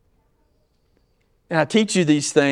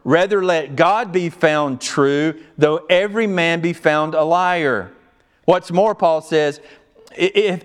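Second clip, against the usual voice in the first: raised, driving pulpit voice; preaching at full force; 5 semitones higher, 4 dB louder than usual. Acted. Pastor pressing Paul's point on the congregation, fervent and raised.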